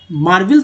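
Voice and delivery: मार्वल said as "maar-vil"; short i in the second syllable of मार्वल; spelling-style "maar-vil"; wrong reading of मार्वल. Shown as a demonstration. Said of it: "तो नहीं पढ़ते हैं".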